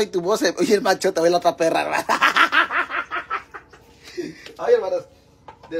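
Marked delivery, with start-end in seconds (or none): 2.1-3.6 carcajada. Real, not acted. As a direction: burst into a long, climbing belly laugh. Bursts out laughing at a remark. (carcajada aguda y escandalosa)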